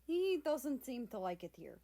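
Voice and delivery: put-on lightly Russian-accented observation. Russia to the pound man, lightly Russian-accented. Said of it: "spoke with a heavy Russian accent".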